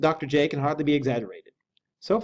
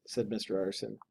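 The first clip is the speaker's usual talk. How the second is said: ordinary delivery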